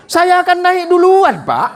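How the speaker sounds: high, loud and slow, digging his heels in; stubborn passenger loudly refusing to give up the front seat; stubborn insisting. Acted. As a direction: refuse to budge, slowly and loudly.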